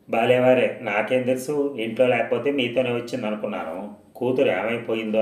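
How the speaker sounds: plain reading voice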